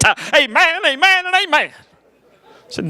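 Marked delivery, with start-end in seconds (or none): 0.0-1.7 high, rising preacher amens; preacher triplicate. Retold or imitated. Dad the preacher triple amen, high. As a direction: stack the amens like a sermon. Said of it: "they talk in triplicate".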